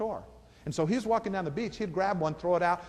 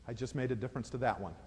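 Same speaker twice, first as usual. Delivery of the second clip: quiet sincere reply; 7 semitones lower, 10 dB quieter than usual. Acted. Hero's quiet final answer.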